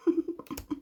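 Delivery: short unvoiced laugh; breathy laugh. Real, not acted. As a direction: laugh softly at yourself. Laughs at own slip.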